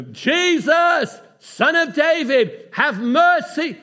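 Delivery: high, loud and drawn-out plea; desperate shouted plea for mercy. Acted. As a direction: cry out with everything you've got. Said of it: "he shouted even louder".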